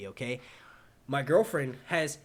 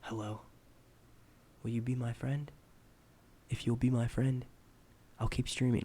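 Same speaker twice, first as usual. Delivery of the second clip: lower, halting weirdo plea; mock creepy; 3 semitones lower than usual. Streamer pleading with newcomers for friendship, mock creepy. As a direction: play a needy oddball, pausing between phrases.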